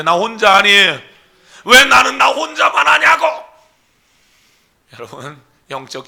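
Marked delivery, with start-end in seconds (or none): 0.0-3.3 high loud shout of complaint; shouting outcry. Retold or imitated. Pastor voicing Elijah's outcry, high shout.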